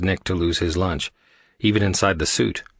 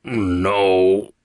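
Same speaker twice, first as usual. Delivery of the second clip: a long, pained groaned word; mildly distressed character voice; obvious distress; 4 dB louder than usual. A narrator's reading. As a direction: drag it out in distress. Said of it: "voice held obvious distress".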